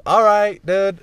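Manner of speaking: high, loud imitation of a friend agreeing; acted friend's reply.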